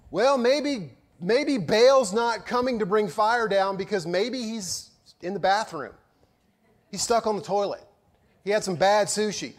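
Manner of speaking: high, loud sarcastic taunt at the prophets; mocking. Elijah mocks the prophets of Baal, sarcastic taunting. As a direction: jeer at them like a smug heckler. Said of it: "And he begins to mock them".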